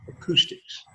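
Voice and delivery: murmured model word, barely voiced; quiet echo of Acoustics for the class; soft repeat. Shown as a demonstration. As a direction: say Acoustics under the breath as an echo. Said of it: "that word is used in our language with acoustics".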